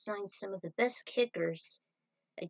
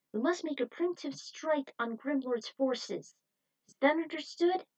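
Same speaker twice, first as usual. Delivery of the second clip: rallying commander; 6 semitones higher than usual. Acted. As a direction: rouse the whole team. Commander rallies troops.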